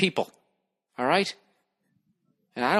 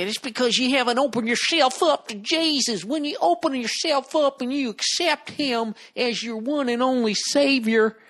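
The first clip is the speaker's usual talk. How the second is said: higher, sweet churchy pitch; preachy believer; 8 semitones higher than usual. Retold or imitated. Comic voicing believers who will reply, higher churchy tone. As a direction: sell Jesus like an earnest evangelist. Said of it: "I know what they're gonna say".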